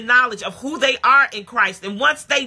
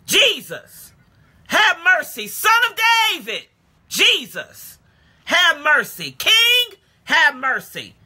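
yelling out; 6 semitones higher, slower than usual. Acted. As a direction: cry out to Jesus like a desperate blind beggar. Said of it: "yelling out in Luke chapter eighteen".

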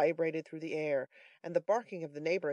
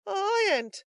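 very high, loud denial; indignant Brittles; 5 dB louder than usual. A narrator's reading. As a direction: deny it in a high voice.

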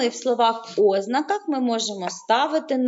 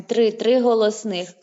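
ordinary delivery

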